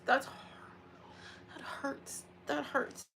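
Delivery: hushed, high, tearful words; near-whispered pain, slowed by emotion; hurting. Real, not acted. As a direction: barely get it out through real tears. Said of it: "That actually hurts".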